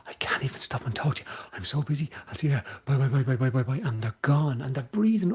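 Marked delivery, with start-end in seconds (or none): 0.1-3.8 quick, breathless brush-off of someone too busy to stop; hurried goodbye on the way out the door; rushed and busy. Pretend. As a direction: rattle it off like you're already leaving. Busy hurry-up person rushing off.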